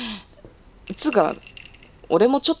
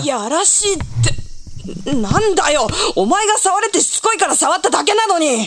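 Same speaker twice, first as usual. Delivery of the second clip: sudden angry snap, raised high; angry outburst; 8 semitones higher than usual. Acted. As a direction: blurt it out in indignant anger. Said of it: "何マジギレしてんの".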